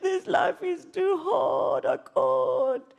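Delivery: slow, high tearful plea; sobbing prayer of despair; crying. Retold or imitated. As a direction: wail it through tears. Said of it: "while I'm crying like this".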